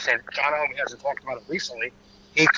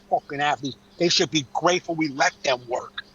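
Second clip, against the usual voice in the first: ordinary talk, nothing marked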